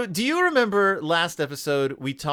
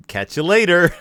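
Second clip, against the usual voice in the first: higher, louder flippant sign-off; departing creature; 6 semitones higher, 6 dB louder than usual. Retold or imitated. Host voicing the thing leaving her body, flippant and loud. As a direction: toss it out as a jokey creature farewell. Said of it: "And then the thing's like".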